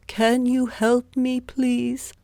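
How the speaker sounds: high, pleading beggar voice; a poor man begging for help; poor man's plea. A narrator's reading. Poor man asks Zheng for help, high and pleading. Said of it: "the man asked Zheng".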